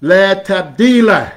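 La tabdila high, loud and slowed; powerful negation; emphatic la tabdila. Shown as a demonstration. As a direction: proclaim la tabdila strongly. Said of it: "la of absolute negation".

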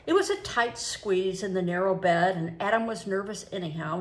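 ordinary delivery

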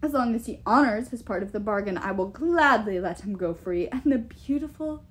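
imperious giant with loud, swelling emphasis; boastful giant king. Acted. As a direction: boast like a king sure of his power.

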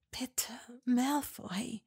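slow, heavy whispered plea. A narrator's reading. Hermine whispering a plea to Malfoy. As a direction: breathe the plea out. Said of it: "wisperte sie schwer".

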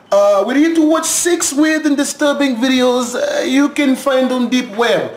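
hammed-up Russian accent. Stand-in Sergi introducing scary videos to viewers, Russian accent. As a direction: put on an amateurish Russian accent. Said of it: "that's my Russian accent".

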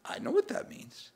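hushed whisper of sudden understanding. Retold or imitated. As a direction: breathe it as a secret realization. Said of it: "The guy went".

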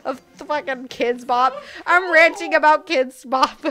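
loud, amused through laughter; real laughter spilling into the words; laughing. Real, not acted. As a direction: rant while cracking up at yourself. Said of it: "I'm just laughing".

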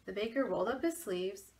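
plain reading voice

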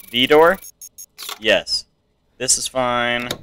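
ordinary delivery

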